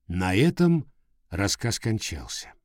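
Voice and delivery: plain reading voice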